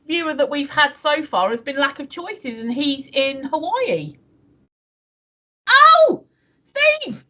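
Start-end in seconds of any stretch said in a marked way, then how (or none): 5.7-7.2 high, loud delighted call of Steve; excited call. Real, not acted. Calling out to Steve about a viewer, high and excited. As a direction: sing out his name in delight.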